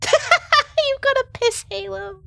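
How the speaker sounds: high, slow, cracking up; laughing tease. Real, not acted. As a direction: giggle through the words. Laughing tease about ring light.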